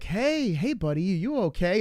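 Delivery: high, swooping imitation of a doctor; flamboyant doctor. Doctor greeting a stung little boy, flamboyant and high-pitched. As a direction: play a flamboyant doctor checking on a kid. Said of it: "little flamboyant".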